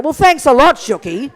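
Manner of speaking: dripping sarcasm toward Shuki; sarcastic. Pretend. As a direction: thank him with heavy irony.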